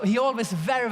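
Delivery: usual speaking voice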